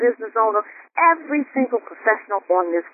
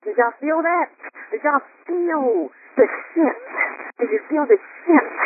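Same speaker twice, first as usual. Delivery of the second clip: rousing question; 7 semitones higher than usual. Shown as a demonstration. Host rousing the call, higher and fervent. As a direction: stir them up to feel it. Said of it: "host rousing the call".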